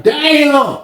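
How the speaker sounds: high drawn-out damn; shocked exclamation. Retold or imitated. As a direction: react in disbelief. Inmates reacting.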